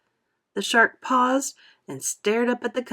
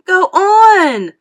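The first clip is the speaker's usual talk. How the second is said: much higher, louder cry; frantic shout; 12 semitones higher, 13 dB louder than usual. A narrator's reading. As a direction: scream it in panic. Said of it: "they cried frantically".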